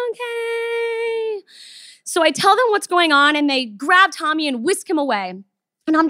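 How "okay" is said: small, soft, high okay; meek okay. Retold or imitated. Answering the staff who told her to calm down, a meek soft okay. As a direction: give in meekly and quietly.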